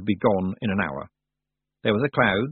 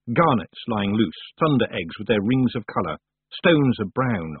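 usual speaking voice

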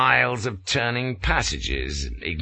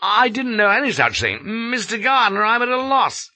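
flustered; 14 semitones higher, 4 dB louder than usual. A narrator's reading. Flashman flustered reply.

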